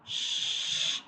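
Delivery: long unvoiced shh; shushing sound. Shown as a demonstration. Teacher showing the sheen sound, long shush. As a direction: hush as if asking for quiet. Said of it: "like be quiet".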